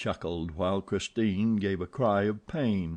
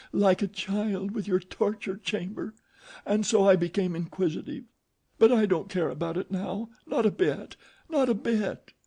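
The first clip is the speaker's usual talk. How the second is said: much higher, terrified pleading; anguished fear; 12 semitones higher than usual. Acted. Christine terrified, begging Eric in the dark. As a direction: beg in panic, voice cracking high. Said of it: "Christine's voice of anguish".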